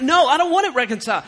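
loud, high, fast refusal; acted-out angry interruption; mock lashing out. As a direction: cut in and refuse hotly. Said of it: "So they begin to lash out".

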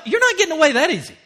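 loud, high and fast retort; acted-out refusal to let it go; indignant inner retort. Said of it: "seriously, I'm thinking to myself".

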